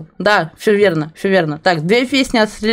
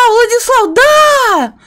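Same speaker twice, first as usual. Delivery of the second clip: excited shout; 12 semitones higher, 5 dB louder, slower than usual. Real, not acted.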